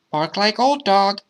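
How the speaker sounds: put-on high child coaching a pet; high-pitched, urging child; child's voice. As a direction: instruct in a high little-kid voice. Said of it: "the child told My Dog".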